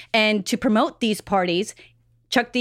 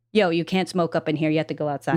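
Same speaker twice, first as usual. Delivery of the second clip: a hint of [name], pitched down; lightly voiced [name]; 7 semitones lower, faster than usual. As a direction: lay down the rule in a low voice. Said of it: "the first words [name] ever said".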